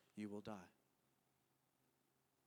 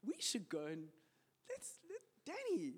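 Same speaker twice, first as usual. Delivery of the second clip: mock teasing; 10 semitones higher than usual. Mocking a happy colleague.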